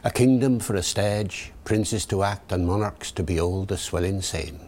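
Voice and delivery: original pronunciation, rhotic Elizabethan accent; Early Modern English verse accent; Shakespearean original pronunciation. Shown as a demonstration. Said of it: "David the O P".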